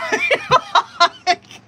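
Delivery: high, loud burst of laughter. Real, not acted. Reader laughing at own timing muddle, high loud laugh. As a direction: crack up in a high-pitched cackle.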